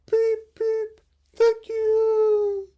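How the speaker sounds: very high, loud peeping thanks; grateful chicks. Acted. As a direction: call thanks like little chicks. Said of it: "called the little birds".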